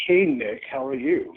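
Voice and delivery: upbeat, lively hello; happy greeting. Real, not acted. Wrestler greets a reporter on a press call, happy. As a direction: greet him cheerily, with a little lift in volume. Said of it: "You sound really happy today".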